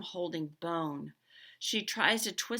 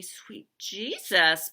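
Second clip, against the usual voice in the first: amazed exclamation; 10 dB louder, much slower than usual. A narrator's reading. Piper amazed at the ball.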